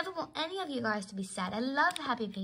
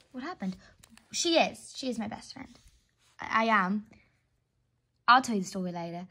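ordinary delivery

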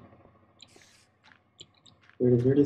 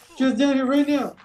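higher, more desperate repeat; frantic shout; 10 semitones higher, 4 dB louder than usual. Real, not acted. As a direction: push it up, more urgent.

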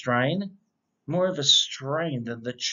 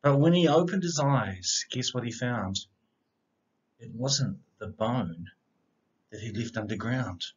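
bouncy rhyming read-aloud, opening lines louder; picture book reading; 2 semitones lower, 6 dB louder than usual. A narrator's reading. Reading a rhyming dog story aloud to children, lively storyteller voice. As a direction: read it like a bedtime story for kids.

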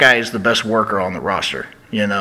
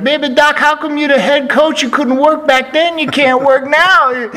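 Dusty Rhodes impression; 6 dB louder than usual. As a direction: needle the head coach in a hammed-up voice. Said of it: "he'd be like".

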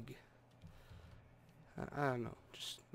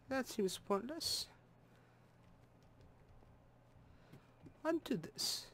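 put-on higher, lightly held German accent; a hint of German accent left over; lingering German voice; 6 semitones higher than usual. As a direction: still stuck in the German voice. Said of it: "why am I still talking like that?".

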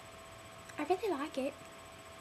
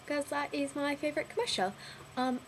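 ordinary talk, nothing marked

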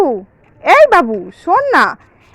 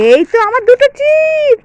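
high, drawn-out "aha, found it" cry; triumphant discovery; 7 semitones higher than usual. Acted. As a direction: catch the little thief out, stretching it high. Finds hidden chips on the child.

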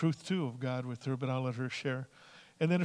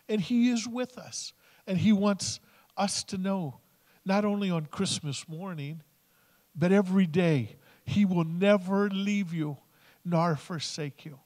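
fervent preaching; 3 semitones higher, 8 dB louder, slower than usual. Acted. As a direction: preach it out to the congregation with full force. Pastor rises to full preaching.

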